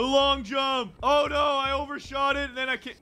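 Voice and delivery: high-pitched excited cry; hyped dismay. Real, not acted. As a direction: call the jump, then wail at overshooting. Overshoots a jump.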